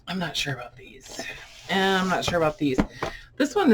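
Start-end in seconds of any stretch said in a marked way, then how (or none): 0.1-1.2 breathy whisper to herself, unsure; hushed uncertain whisper; whispered aside. Real, not acted. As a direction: whisper the doubt under her breath. Muttering doubt over old books.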